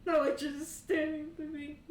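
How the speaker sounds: loud laughing high voice; cracking up mid-scene. Real, not acted. GM breaks character laughing at the player.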